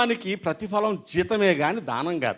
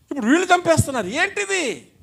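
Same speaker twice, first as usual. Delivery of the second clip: higher, rising outrage ending on ఏంటిది; indignant outcry; 12 semitones higher, 3 dB louder than usual. Real, not acted. Preacher cries out to the congregation against the killings, indignant. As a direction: cry out in disbelief at the evil. Preacher decrying society's evils.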